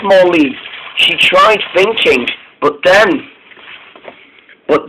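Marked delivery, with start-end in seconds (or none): none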